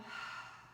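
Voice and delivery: soft throaty hiss of breath through the nose; whisper breath. Shown as a demonstration. Yoga teacher shows the class the breath sound, a throaty whispered exhale. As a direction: push the air out the back of the throat like an ocean sigh. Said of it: "We call it our whisper breath or the Ujjayi breath".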